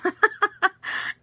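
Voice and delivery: real high-pitched giggle; laughing. Real, not acted. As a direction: burst out in a high, helpless laugh. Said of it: "why I'm laughing so much".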